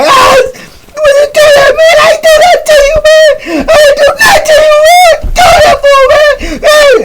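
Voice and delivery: very high, loud, wailing; screaming in pain. Retold or imitated. Burned young boy wailing at the old head in pain. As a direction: shriek it like you're scalded. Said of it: "He like".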